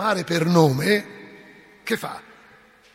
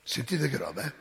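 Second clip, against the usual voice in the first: normal talking voice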